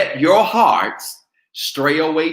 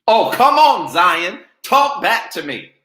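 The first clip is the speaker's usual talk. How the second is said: calling out; 7 semitones higher, 6 dB louder than usual. Real, not acted. Preacher calling on congregation.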